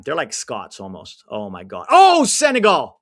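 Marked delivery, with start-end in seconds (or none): 1.9-2.9 loud, high groan of near-miss excitement; excited shout. Real, not acted. Fan yelling at the screen over a near miss, excited. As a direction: cry out as the chance goes wide.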